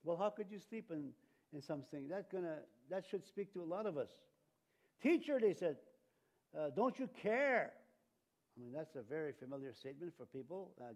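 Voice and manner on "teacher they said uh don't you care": high, loud cry to the teacher; pleading disciples. Acted. Disciples crying out to Jesus in the storm, pleading.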